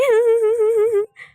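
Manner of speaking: loud, high mocking laugh. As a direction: laugh loudly at your own jibe. Laughs after mocking mother's age. (चिढ़ाने वाली ऊँची हँसी)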